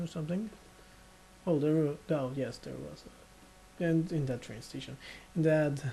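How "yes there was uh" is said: drowsy, fading mumble; half-asleep trailing off; nodding off. Real, not acted. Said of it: "I'm falling asleep as I'm recording this".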